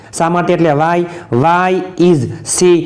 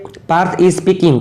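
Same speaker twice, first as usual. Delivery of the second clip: slow dictation; much slower than usual. Shown as a demonstration. Teacher models English sentence slowly.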